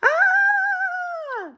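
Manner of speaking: shrill cartoon scream, loud; high comic scream. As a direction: scream like a startled elephant. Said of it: "Kinda screams".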